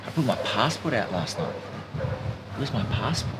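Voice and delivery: rising panic murmured, mostly hushed; mild panic. Retold or imitated. To himself, hunting for his wallet, hushed panic. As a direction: talk yourself through rising panic under your breath.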